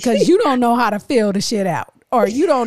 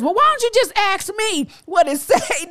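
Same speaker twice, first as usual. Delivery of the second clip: mock exasperated partner; 8 semitones higher than usual. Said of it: "then you like this".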